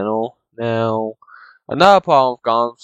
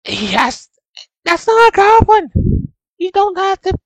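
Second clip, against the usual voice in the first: much higher mocking falsetto, slow; mock squeaky voice; 3 dB louder, slower than usual. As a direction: jump way up into a silly squeak to mock it. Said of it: "It's like".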